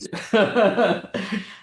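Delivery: bright burst of laughter; real laugh. Real, not acted. Host laughing at the guest's joke, loud laugh.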